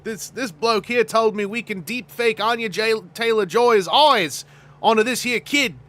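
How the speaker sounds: excitable mock Australian bloke; George Miller. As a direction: play an Australian director marvelling at new tech. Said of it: "George Miller is constantly in a position".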